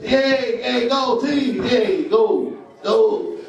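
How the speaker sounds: high excited cheer from the stands; root for the kid like a hyped parent; mock sideline cheering. Cheering parent at kid's game.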